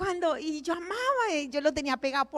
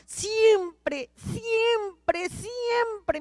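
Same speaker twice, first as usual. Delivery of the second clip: tearful repetition; 7 semitones higher, 4 dB louder, much slower than usual. Real, not acted. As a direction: voice rising and breaking, then slowing. Testimony, emotional repetition. (con la voz quebrada por la emoción)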